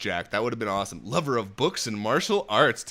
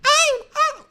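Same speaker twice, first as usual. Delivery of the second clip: very high, loud falsetto puppet voice; Elmo; 6 dB louder than usual. Acted. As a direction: squeak it out as a little puppet. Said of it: "my kids love Elmo".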